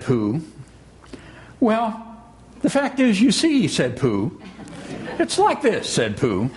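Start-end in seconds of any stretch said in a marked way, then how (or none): 1.6-3.7 high-pitched, halting explanation; flustered Pooh. Acted. Pooh struggles to explain himself. 5.2-5.9 very high-pitched fresh attempt; flustered Pooh. Pooh struggles to explain himself.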